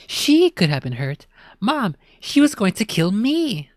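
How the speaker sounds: high, loud indignant protest; protesting kid. Acted. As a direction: argue back, outraged.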